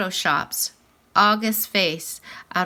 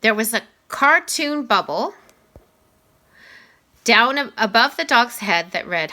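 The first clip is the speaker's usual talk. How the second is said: ordinary delivery, nothing marked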